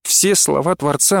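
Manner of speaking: usual speaking voice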